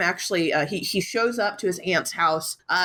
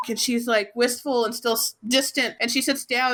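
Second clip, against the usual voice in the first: ordinary delivery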